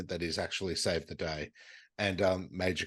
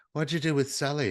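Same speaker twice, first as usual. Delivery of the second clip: Cindy asking; 8 semitones higher, 3 dB louder than usual. Retold or imitated. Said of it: "And Cindy goes".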